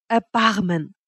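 understated plea for mercy; pleading. A narrator's reading. Lover begs Wanda for mercy, pleading. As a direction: let the word sink, imploring. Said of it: "flehte ich".

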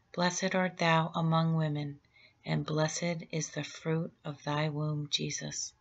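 normal talking voice

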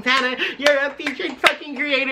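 high-pitched excited fan cheer; happy excitement, retold. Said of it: "genuinely so happy for her".

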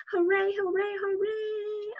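high, lighter cheer. Acted. Reader leading children in the rhyme, mild cheer. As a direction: cheer along gently.

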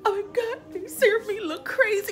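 high mock crying wail; gasping, hammed-up sobby whine; dramatic, worked up. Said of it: "being all dramatic and all worked up".

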